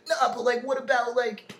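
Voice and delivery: high, loud, whining plea; whiny kid voice. Retold or imitated. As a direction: whine like a pleading teenager. Mocking his younger self to dad.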